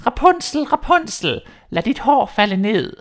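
a witch's call stretched up to the window. Acted. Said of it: "hørte hende sige".

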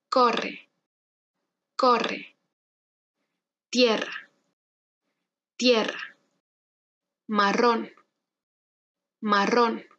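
each word from "Corre" to "Israel" said twice with a trilled R; careful rolled R in every word; strong R drill. Shown as a demonstration. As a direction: pronounce word by word, rolling the R, with gaps for the learner. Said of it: "Intenta conmigo".

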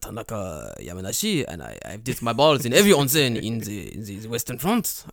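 mock French accent on a self-important onsen lover; snooty French accent. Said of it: "my snooty, I spent one term in France, Japanese person".

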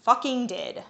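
put-on slow, loud drunk boast; slow, showy tipsy declaration; overdramatic. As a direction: ham it up drunkenly. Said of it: "a bit too dramatically".

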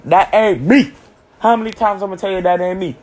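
hammed-up outrage; mock defensive. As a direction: protest like you're cornered. Denying his own Twitter page.

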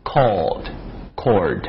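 cold with an l, cord with a curled r; cold versus cord. Shown as a demonstration. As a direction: set cold against cord with a rolled American r. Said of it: "再来一个".

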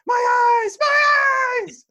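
high, loud scream of mock agony. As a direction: wail it out like a wrestler blinded on the mat. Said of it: "With Kenny Omega screaming on the ground".

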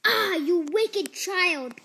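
slow, loud, high scolding cry; a loud, slow enchantress scold; wicked witch cry. A narrator's reading. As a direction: drag out the scolding like an outraged witch. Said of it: "cried the enchantress".

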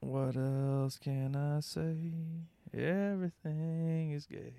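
slow, dry deadpan delivery. Pretend. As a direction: drag it out, bored and dry. Deadpan joke.

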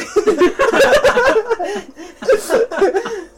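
extended high laughter; sustained loud laughing fit; long loud laugh. Real, not acted. Laughing at doing nothing.

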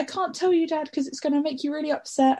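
anxious pleading. Retold or imitated. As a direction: beg him in a high, tense voice. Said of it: "I was like".

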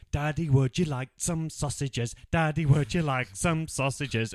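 imitating film character. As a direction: chant it slowly and loudly, mimicking him. Acting out the film's sausage chant.

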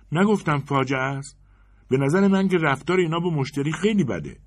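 high, loud indignant complaint; huffy insistence. Acted. Woman in line insisting on the bad service, high and indignant. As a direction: press the point, offended.

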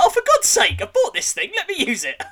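loud, high frustrated cry; exasperated outburst. Retold or imitated. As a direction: vent at the game, half laughing. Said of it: "I was like".

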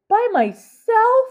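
a high, loud, slow squeak of disbelief; squeaky. A narrator's reading. Nine-year-old girl hears she must travel alone, squeaking. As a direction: her voice cracks up into a squeak. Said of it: "came out in a squeak".

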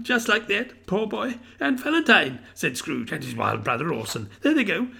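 high, eager, half laughing half crying; an old man giddy with childhood memories; excited old Scrooge. A narrator's reading. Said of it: "a most extraordinary voice between laughing and crying".

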